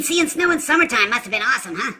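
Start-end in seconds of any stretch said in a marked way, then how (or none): none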